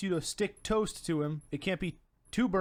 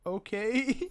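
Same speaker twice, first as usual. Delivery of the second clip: high, rising, doubtful okay; mock-uncertain, pitched up; hesitant compliance; 5 semitones higher than usual. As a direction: imitate unsure agreement, lifting at the end. Player doubtfully obeys odd order.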